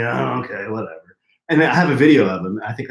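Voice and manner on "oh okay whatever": low, softening mock reluctance; grudging agreement, dropping away at the end; begrudging. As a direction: imitate a big guy grudgingly giving in. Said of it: "he kind of begrudgingly".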